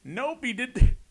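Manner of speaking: high, loud playful reveal; excited. Real, not acted. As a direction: announce it with glee.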